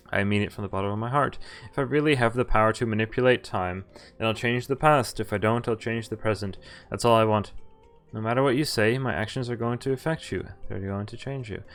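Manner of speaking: normal talking voice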